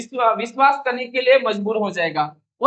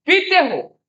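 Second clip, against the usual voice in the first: पीते raised high and hit; stress on पीते; 4 semitones higher, slower than usual. Shown as a demonstration. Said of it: "इस तरह से". (पीते पर ऊँचा ज़ोर)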